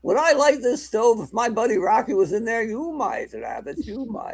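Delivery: high hammed-up tough-guy voice; mock movie gangster. Old gangster movie bit.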